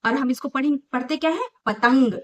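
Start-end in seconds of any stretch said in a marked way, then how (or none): 1.7-2.1 पतंग louder, through the held nose. Shown as a demonstration. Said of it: "त के ऊपर हवा भरी ना". (नाक बंद करके पतंग)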